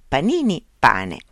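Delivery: ordinary delivery, nothing marked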